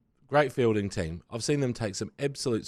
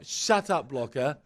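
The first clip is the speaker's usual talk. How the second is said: slow, fed-up Shut up, Blocker; a hint of exasperated fan; mock fed-up retort; 7 semitones higher, 3 dB louder, slower than usual. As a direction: tell him off, dragging the last words. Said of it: "I'm like".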